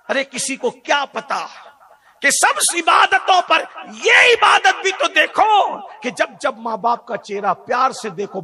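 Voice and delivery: loud, high preacher's cry; loud sermon climax with laughs; fiery preaching. Acted. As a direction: thunder it at the congregation. Preaching at full force.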